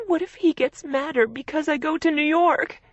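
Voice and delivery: high, loud, frightened question; scared sister asking for reassurance; panicked. A narrator's reading. Sister fearing fiancé's anger, panicked and high. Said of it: "She sounded panicked".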